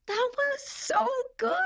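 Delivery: high-pitched gushing self-admiration; gushing praise. Acted. Actress praising herself as she watches, gushing. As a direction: coo it high, like an adoring fan.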